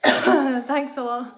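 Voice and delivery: slow, loud and sarcastic. Real, not acted. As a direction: drag it out with mock gratitude. Teasing Scott after laugh.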